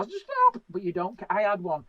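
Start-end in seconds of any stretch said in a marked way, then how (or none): 0.0-0.6 high squeaky cry of stop; heart crying stop. Retold or imitated. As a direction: shriek it like a begging heart. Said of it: "you can hear your heart go".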